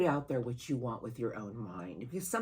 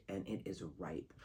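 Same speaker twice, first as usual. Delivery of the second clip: whispered; 7 semitones lower, 10 dB quieter than usual. Real, not acted. Reading promises ripe harvest.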